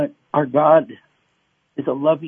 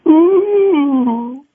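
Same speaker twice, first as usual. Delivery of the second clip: high wordless groan, crying; a loud, high sob-like ooh; broken cry; 6 dB louder than usual. Retold or imitated. Believer crying to God without words, loud high groan. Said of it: "you're crying and you're broken".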